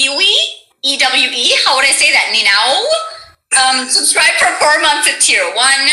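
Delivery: ordinary delivery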